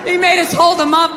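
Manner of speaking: ordinary delivery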